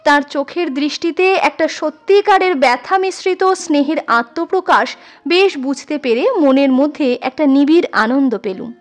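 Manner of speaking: usual speaking voice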